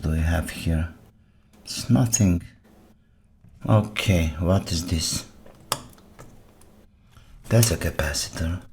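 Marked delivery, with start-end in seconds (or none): none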